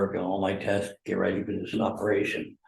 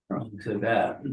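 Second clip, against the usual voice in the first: ordinary talk, nothing marked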